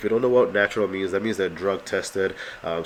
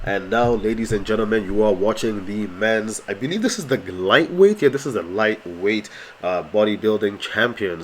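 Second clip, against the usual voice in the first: louder show host voice; announcer voice; 4 dB louder than usual. Acted. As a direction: introduce it like an MC. Announces the next class.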